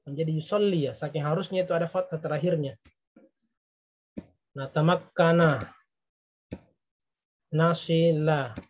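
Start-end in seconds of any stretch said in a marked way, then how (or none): 4.6-8.6 Nasila, najida, nata'allama said slowly, final -a stretched; teacher drilling endings slowly; slow clear ending. Shown as a demonstration. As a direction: drill each verb slowly, showing the fathah ending. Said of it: "Semua ini berakhiran fathah".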